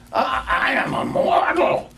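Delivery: high, loud panicky protest; frightened protest. A narrator's reading. Scrooge pleads with the ghost in a high fright. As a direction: cry out in a fright, stammering. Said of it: "Scrooge remonstrated".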